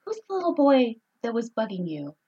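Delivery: hint of a protective mother; movie mom. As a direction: imitate a menacing mom questioning her kid. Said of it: "the mom comes up and she goes".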